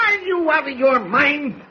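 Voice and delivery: high, drawn-out exasperated cry; exasperated disbelief. Acted. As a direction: stretch it out, high and appalled. Editor appalled at reporter's silence.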